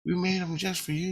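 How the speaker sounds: high, coaxing offer; sweet offering voice. Retold or imitated. Dream people pushing food on him, high sugary coaxing. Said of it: "They go".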